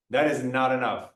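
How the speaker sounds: normal talking voice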